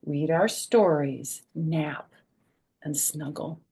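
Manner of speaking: gentle slow read fading to whisper; reading to children. A narrator's reading. Grandma reading cuddle page to kids, fading to whisper. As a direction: soften to a whisper by the end. Reads book text.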